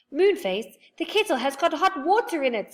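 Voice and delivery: high, loud worried warning; alarmed warning. A narrator's reading. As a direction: warn him urgently.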